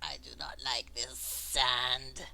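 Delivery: low, breathy alien whisper; whispered creature thoughts. Acted. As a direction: think it in a strange whisper.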